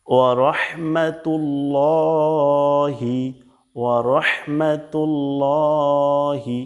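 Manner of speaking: "ওয়া রহমাতুল্লাহি" as wa-rohmatul-looohi; heavy ro. Shown as a demonstration. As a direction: slowly intone it with a long rounded "llo". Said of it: "ওয়া রাহমাতুল্লাহি না". (ভারী র দিয়ে টেনে উচ্চারণ)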